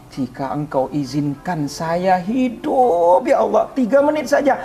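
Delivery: pleading that climbs to a high wail; retold tearful cry. Man begging Allah for minutes of life, wailing. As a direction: draw the words out, then cry them high. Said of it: "te-, berteriak, nangis sama Allah".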